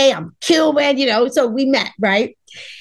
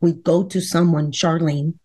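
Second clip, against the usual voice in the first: stern husband; 7 semitones lower than usual. Retold or imitated. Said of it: "husband voiced in story".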